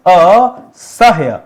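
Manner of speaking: असह्य stretched very slowly, the ह्य cluster held; slow model word. Shown as a demonstration. Teacher showing the half ह, very slow. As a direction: draw out असह्य for the class. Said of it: "ये ह के नीचे हलन लगा दे रहा हूं".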